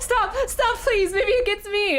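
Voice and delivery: high, loud pretend panic; mock panic. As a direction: beg in hammed-up alarm.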